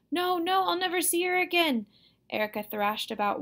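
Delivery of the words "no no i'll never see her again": put-on very high, louder child's protest; high, distraught crying-out; hysterical wail. A narrator's reading. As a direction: wail as if all is lost. Hysterical girl refuses to wait.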